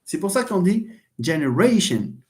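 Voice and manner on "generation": "generation" with the weight on RA; stress on RA. Shown as a demonstration. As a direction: punch the next-to-last syllable of "generation". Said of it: "ولكن stress على هذا".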